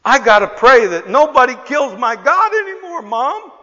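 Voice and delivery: put-on earnest small boy, pitched high; little boy pleading. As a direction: imitate a four-year-old's insistent plea. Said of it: "the little boy then says".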